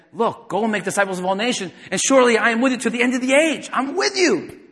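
rising, loud, high proclamation; voicing Jesus. Preacher quoting Jesus to the congregation, rising loud and high. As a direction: build it up to a ringing promise. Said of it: "that's what Jesus is saying".

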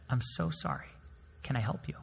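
softly, politely defusing the anger; calm and soft. Retold or imitated. Server calmly answering a yelling customer, soft and polite. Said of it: "My professor calmly says".

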